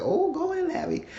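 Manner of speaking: teasing Larry in a mock voice; mock high tease. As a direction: cheer Larry on with a squeaky tease.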